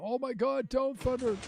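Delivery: high-pitched alarmed yelp; alarmed squeal. Real, not acted. To the game weather as thunder starts, a high alarmed squeal. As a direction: squeal at the sky in real alarm.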